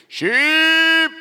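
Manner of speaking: loud, high, drawn-out yell; farmer's sheep call. Retold or imitated. Son imitating how the farmer called the sheep, long high yell. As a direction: holler one long high call across a field. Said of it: "the kids could yell it".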